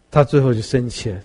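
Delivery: ordinary delivery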